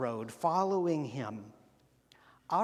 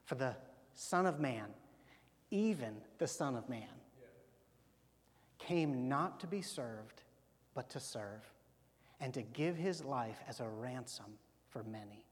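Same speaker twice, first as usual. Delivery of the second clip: solemn reading; 5 semitones lower, 7 dB quieter than usual. Real, not acted. Pastor reading Jesus's words to the congregation, hushed and reverent. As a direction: read it low and hushed, letting each phrase land.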